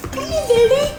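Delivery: high cooing voice to a kitten; squeaky sing-song pet talk. Real, not acted. Calling the cat in baby talk.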